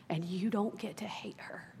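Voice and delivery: whispered and slow, tender but firm; gentle whisper. Retold or imitated. Father whispering firmly to his crying daughter. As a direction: lean in and whisper it.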